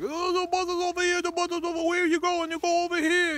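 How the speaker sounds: high, loud put-on shouting over the crowd; hammed-up yell of a pushy bus hawker; mock yelling bus touts. Traveler mimics screaming bus staff, mock high yell. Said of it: "ten people all screaming at each other".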